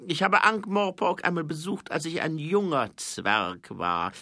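high, loud, measured king's voice; herrischer Zwergenkönig. A narrator's reading. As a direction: speak down to an envoy, high and forceful. (hoher, lauter Zwergenkönig, bedächtig)

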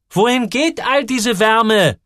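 slow, high, loud call over the noise. A narrator's reading. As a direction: shout over the roaring boiler. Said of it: "Er musste rufen". (laut gerufen über den Lärm)

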